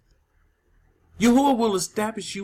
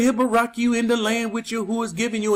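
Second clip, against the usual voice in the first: quick, throwaway reading of the verse; rushed skim-over; faster than usual. Retold or imitated. Preacher mimics pastors skipping the verse, rushed. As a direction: rattle it off like it doesn't matter. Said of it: "They don't put emphasis on it".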